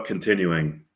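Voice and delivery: normal talking voice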